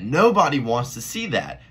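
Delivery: loud exasperated rant line; exasperated outburst. Real, not acted. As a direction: throw it out in disbelief. Rant, repeated louder about Mallet fight.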